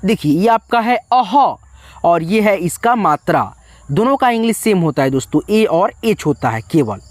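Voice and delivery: normal talking voice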